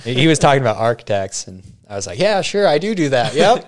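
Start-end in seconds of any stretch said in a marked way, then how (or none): 2.2-3.7 high, mock-eager agreement; brushing off a guy insulting engineers, sarcastic; sarcastic yes. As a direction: agree just to end it.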